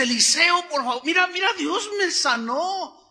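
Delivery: high-pitched excited retelling of good news. Retold or imitated. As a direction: act out an overjoyed man, voice jumping up. Healed man runs to tell prophet.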